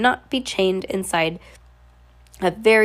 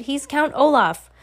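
higher-pitched accusation; crying out; 6 semitones higher than usual. A narrator's reading. Klaus exposes Count Olaf to his guardians, crying out. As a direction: call it out insistently. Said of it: "Klaus cried".

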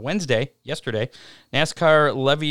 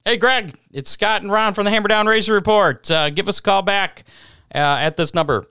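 loud voicemail greeting; 9 semitones higher, 8 dB louder than usual. Real, not acted. Leaving a voicemail for Greg.

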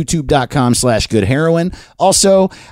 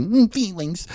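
soft, higher hum into the word; mock emotional murmur; 6 semitones higher, 7 dB quieter, slower than usual. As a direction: hum first, then say it small and high. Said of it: "Or sometimes they're like".

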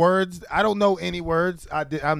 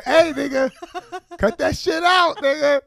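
much higher mock scold; 11 semitones higher than usual. Host imitates guest's professional scolding, much higher. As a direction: scold like a prim boss in slang. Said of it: "She professionally telling me".

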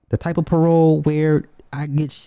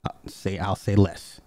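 whispered aside; 5 semitones lower, 5 dB quieter than usual. Real, not acted.

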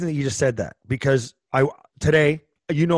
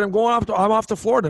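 a higher, lightly voiced old man; 9 semitones higher, faster than usual. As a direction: keep the old-timer's lifted tone. Old man continues quote.

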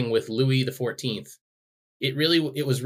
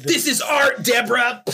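shouting; 13 semitones higher, 7 dB louder than usual. Pretend. Husband defends song to wife.

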